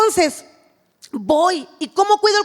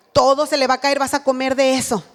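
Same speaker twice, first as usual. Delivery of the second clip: ordinary talk, nothing marked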